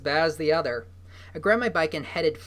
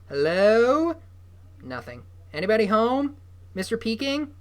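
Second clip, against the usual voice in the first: loud, high call into an empty room; 7 semitones higher, 6 dB louder than usual. Acted. Noah calling into the empty trailer, loud and high. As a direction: call out hoping someone answers.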